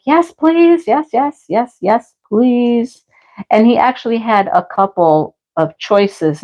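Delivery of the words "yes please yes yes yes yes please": eager yes. Retold or imitated. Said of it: "I was like".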